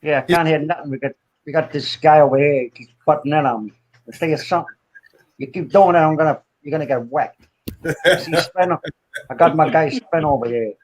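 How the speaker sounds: put-on casual tough-guy talk in a New York accent; New York wise guy. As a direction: play a New York mobster. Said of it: "New York voice".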